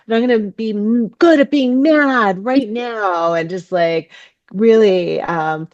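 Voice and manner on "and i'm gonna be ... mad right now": quick, higher, bright mock-determined declaration; playful resolve. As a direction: announce you'll be good at being mad, half joking. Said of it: "And I'm like".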